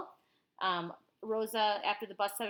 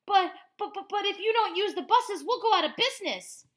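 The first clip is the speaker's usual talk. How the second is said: high, loud stammering protest; panicked pleading; 11 semitones higher, 9 dB louder than usual. Acted. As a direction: beg them in a flustered rush. Bus company pleading with boycotters.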